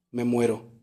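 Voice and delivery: dying seed, lightly voiced.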